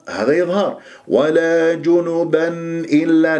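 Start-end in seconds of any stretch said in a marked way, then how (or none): 1.1-3.4 جنبا إلا chanted slowly, audible n before إلا; clear nun. Shown as a demonstration. Teacher models the joining, clear nun. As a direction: sound the nun fully between جنبا and إلا. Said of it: "نسمع النون المظهرة".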